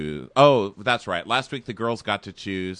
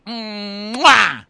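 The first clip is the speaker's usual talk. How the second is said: a big, high, loud mock kiss sound; hammed-up kiss noise; loud smacking kiss; 11 semitones higher, 7 dB louder than usual. Twin grabs his face, kisses.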